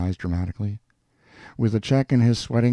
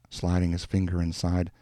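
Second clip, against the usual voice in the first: usual speaking voice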